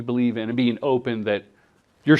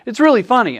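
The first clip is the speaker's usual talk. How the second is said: mock enthusiastic; 9 semitones higher, 4 dB louder, faster than usual. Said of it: "And they go".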